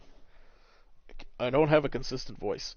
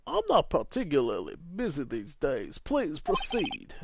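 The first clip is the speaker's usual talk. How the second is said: grand, drawn-out lawyer voice; measured, swooping theatrical delivery; pompous older lawyer; 5 semitones higher, slower than usual. Acted. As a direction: play a self-important attorney, unhurried. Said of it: "that's him".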